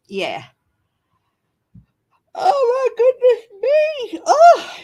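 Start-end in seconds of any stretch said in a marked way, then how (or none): 2.3-4.7 talking through a big yawn; words stretched by a yawn. Real, not acted. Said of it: "sorry for the yawns".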